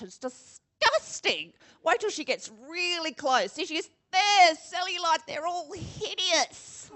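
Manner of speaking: mock the models with snide glee; hammed-up catty spectator; catty gossip voice. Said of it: "And it goes like".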